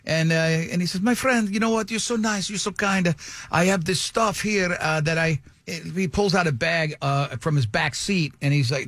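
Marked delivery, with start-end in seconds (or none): 1.0-5.4 imitated effusive Italian-accented English; butter him up in an Italian accent. Italian dealer flattering his new friend, Italian accent. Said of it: "that is the accent he used".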